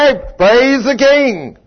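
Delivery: high, loud, drawn-out praise; joyful praise. Retold or imitated. Dave greeting the preacher, drawn-out joyful praise. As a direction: stretch it out in celebration. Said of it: "He knows what I".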